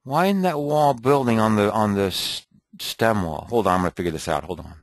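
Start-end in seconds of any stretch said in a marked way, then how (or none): none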